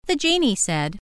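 normal talking voice

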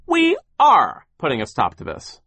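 forceful comeback leaning on "are"; emphatic retort. A narrator's reading. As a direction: stress on "are", high and loud. Sir retorts to Charles.

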